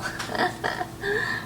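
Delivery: airy unvoiced giggle; breathy laugh. Real, not acted. Laughing at the food.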